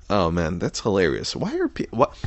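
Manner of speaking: ordinary talk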